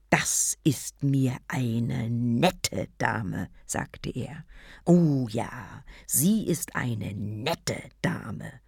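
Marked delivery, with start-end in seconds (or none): 0.1-3.5 slow, whispered sarcasm; sarcastic whisper. Acted. Fuming to himself. 4.8-8.5 sarcastic. Acted. Sarcastic repeat.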